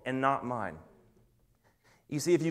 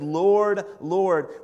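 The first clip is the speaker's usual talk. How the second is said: loud, high, desperate call of Lord; pleading cry; 3 semitones higher, 6 dB louder than usual. Acted. Followers begging Jesus on Judgment Day, loud and pleading.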